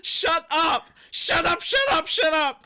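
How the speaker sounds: high, loud shout at a teasing friend. Real, not acted. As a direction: yell it over and over. Laughing friend teases during setup.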